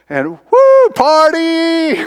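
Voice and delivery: high whooping party cheer; woo party. Retold or imitated. Retelling the moonshine swig, whooping with glee. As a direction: whoop it up like the drink just hit.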